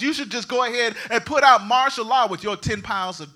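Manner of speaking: loud, driving sermon delivery; fired-up pastor rebuking the crowd; full-force preaching. Acted. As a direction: push the message home.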